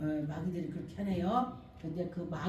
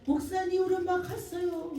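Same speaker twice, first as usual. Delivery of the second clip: high voice of a daughter reporting a death; daughter's news; 9 semitones higher than usual. Retold or imitated. Daughter telling the pastor her mother died, high voice. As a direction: tell the pastor she has passed, pitched high.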